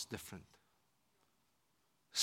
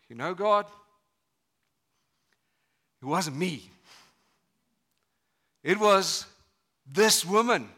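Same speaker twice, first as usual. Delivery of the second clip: indignant excuse; 5 semitones higher, 11 dB louder than usual. Acted. Mocking Adam's excuse, loud.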